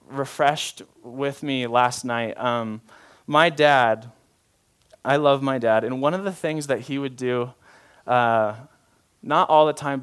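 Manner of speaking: normal talking voice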